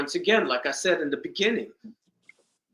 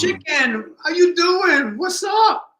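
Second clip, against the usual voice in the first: high, loud happy shout across the club; excited greeting; 12 semitones higher, 6 dB louder, slower than usual. Retold or imitated. Brother spotting his sister in a nightclub, shouting delighted hello.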